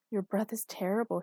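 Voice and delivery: plain reading voice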